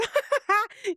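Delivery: high, imitated cackle; mimicked mocking laugh. Nurse laughs at the patient's question, mock laughing. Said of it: "she laughs at me again".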